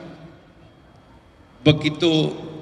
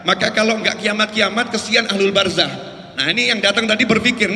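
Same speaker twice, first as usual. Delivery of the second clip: ordinary talk, nothing marked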